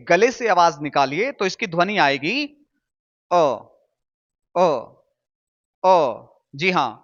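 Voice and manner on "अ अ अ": अ from the throat, mouth barely open; correct vowel model. Shown as a demonstration. Teacher showing how the throat makes it, small-mouthed vowel. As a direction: separate "uh" sounds for अ, neatly spaced. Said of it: "मुंह को कम से कम खोलिए".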